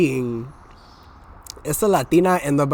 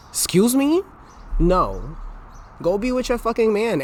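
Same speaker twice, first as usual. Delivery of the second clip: indignant excuse me; 8 semitones higher than usual. Real, not acted. Indignant retort.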